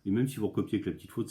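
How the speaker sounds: ordinary talk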